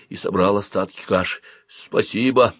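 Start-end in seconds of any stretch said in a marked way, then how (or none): none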